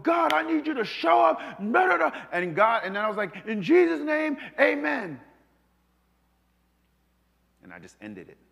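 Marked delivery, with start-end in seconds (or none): 0.0-5.2 mock fervent shout.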